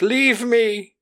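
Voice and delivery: high, strained mock plea; dramatic hero plea. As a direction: beg them to go. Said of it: "he's like".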